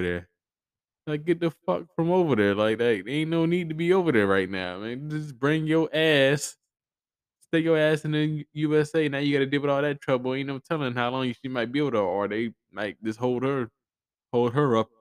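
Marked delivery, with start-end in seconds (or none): 1.1-10.3 raised, fed-up rant; exasperated outburst. Real, not acted. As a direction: tell her off from afar, exasperated.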